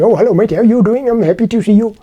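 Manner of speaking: put-on high, loud, sing-song Indian accent; imagined Indian-accented hello, pitched well up. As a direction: put on an Indian accent, greeting a mate cheerily. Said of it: "this Indian accent".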